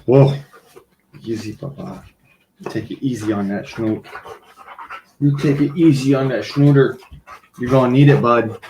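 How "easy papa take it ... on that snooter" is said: soothing the dog. Real, not acted.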